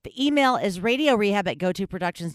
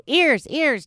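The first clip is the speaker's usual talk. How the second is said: high, louder prompt to a cat; calling a pet cue; 6 semitones higher, 5 dB louder than usual. Retold or imitated. Owner cueing her cat to do its trick, high calling voice. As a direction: coax the cat with a raised voice.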